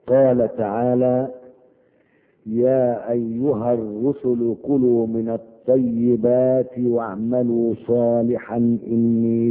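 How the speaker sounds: slow, measured sermon delivery; slow solemn preaching voice; solemn recitation. Acted. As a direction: weigh each word of the hadith. Solemn slow hadith and verse.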